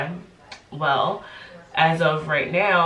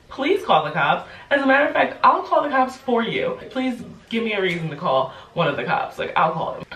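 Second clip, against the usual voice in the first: defiant retort; 6 semitones higher than usual. Retold or imitated. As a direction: challenge him to call. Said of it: "I'm like".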